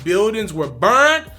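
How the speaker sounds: normal talking voice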